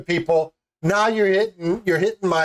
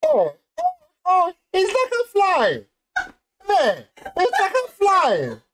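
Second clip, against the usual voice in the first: high, mock-tearful Cajun voice; hammed-up Rose, swooning and near tears; Cajun Rose impression; 13 semitones higher than usual. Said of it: "we can do a pretty good Rose and Jack".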